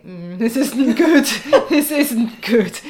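slow, loud comic understatement through laughs; laughing dismay. Retold or imitated. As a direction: replay your dawning dismay, laughing at it. Acts out her thought on floor.

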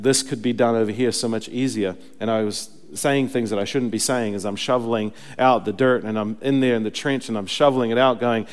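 ordinary delivery

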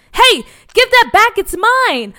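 put-on high, loud cry of a kid who's been robbed; protesting shout. Boy shouting at bullies to return his helmet. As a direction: yell it as you lunge for the helmet.

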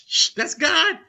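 ordinary delivery

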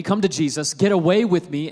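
normal talking voice

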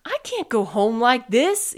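loud, distressed yell. A narrator's reading. Armadillo stuck in a chair, yelling. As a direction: raise your voice in protest. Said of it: "yelled Armadillo".

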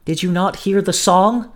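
a touch of trembling dread. A narrator's reading.

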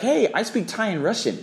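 high, bright-eyed student showing off; boasting student. Retold or imitated. Host imitating a Thai student, high bragging voice. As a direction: brag about your languages.

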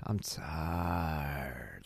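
"tired" stretched out extremely long, low and soft; drained, sagging drawl on "tired"; two-and-a-half-second tired. Shown as a demonstration. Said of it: "two and a half second tired".

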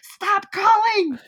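high, loud, slow "Stop calling"; mock annoyed shout. Host playing the fed-up bird yelling at the drunk caller. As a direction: snap it at the caller, hammed-up.

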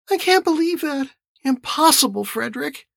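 very high female character voice; disbelieving woman. A narrator's reading. Anna rejects Lowell's claim, frowning, high-voiced disbelief. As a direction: protest in a high, incredulous voice.

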